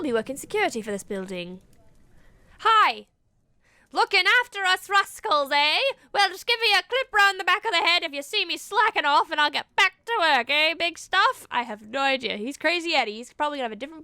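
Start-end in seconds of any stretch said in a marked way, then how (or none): 2.6-11.5 higher, louder Australian bloke; cheeky Australian monster hunter; Australian accent. Acted. Monster hunter Eddie greeting the new witch, Australian accent. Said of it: "I went Australian".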